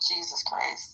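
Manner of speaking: ordinary delivery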